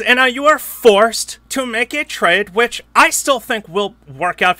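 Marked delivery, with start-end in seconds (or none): none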